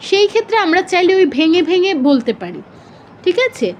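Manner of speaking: ordinary talk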